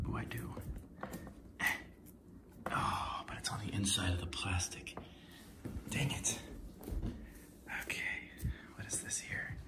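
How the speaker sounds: soft disappointed whisper. Real, not acted. Whispering to himself about the plastic. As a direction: mutter it under your breath. Muttering at the wrapping.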